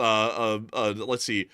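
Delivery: normal talking voice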